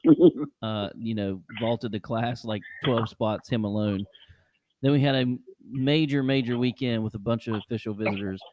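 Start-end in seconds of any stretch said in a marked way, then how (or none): none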